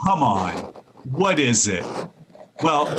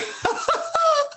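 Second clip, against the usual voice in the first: teasing laugh; 3 dB quieter than usual. Acted. Husband laughing at idea.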